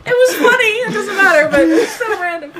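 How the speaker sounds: high, loud talking through laughter; squealing, laughed-out words; real helpless laughter. Real, not acted. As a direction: protest while cracking up. Laughing at the random remark.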